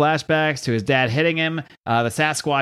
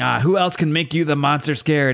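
ordinary delivery

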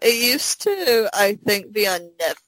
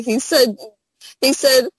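ordinary talk

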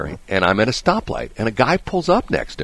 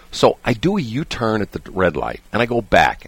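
normal talking voice

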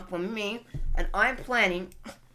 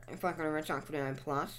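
whispering; 3 semitones lower, 7 dB quieter than usual. Real, not acted. Muttering to self while setting up.

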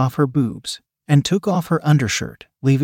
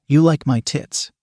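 ordinary delivery

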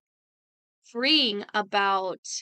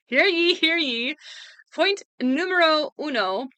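town crier; 6 semitones higher than usual. Pretend. Announcing first point like herald.

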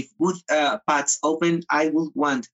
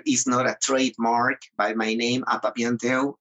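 ordinary talk, nothing marked

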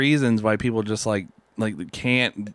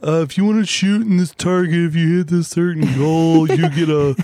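mock announcer; 6 semitones higher, 4 dB louder than usual. Mocking startup office perks.

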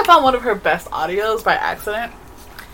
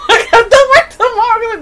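high, loud laughing words; 13 semitones higher, 7 dB louder, faster than usual. Real, not acted. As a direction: squeal it through laughter. Laughing at own story.

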